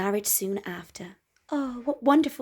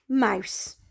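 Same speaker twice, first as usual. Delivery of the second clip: plain reading voice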